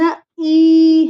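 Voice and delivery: "न ई" slowly split, n then long ee; sounding out. Shown as a demonstration. Mother explains which letters to write, sounds split slowly. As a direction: separate "न" from a stretched "ई". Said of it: "न का उच्चारण आया".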